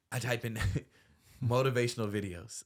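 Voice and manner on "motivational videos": chuckling around the words; amused, self-mocking laugh. Real, not acted. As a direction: laugh at yourself as you say it. Laughing at his own search.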